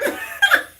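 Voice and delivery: squeaky laugh; high breathy laugh. Real, not acted. Laughing at own story.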